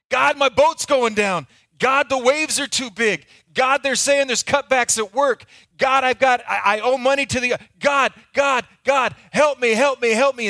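panicked pleading. Acted. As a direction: beg for help as the boat sinks. Panicked prayer in the storm.

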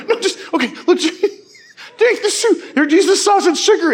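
imitating someone helpless with laughter, very high; high, loud, breaking up while instructing; laughing hard. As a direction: squeal advice through mock tears of laughter. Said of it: "he's in tears laughing at me".

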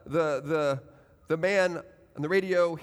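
usual speaking voice

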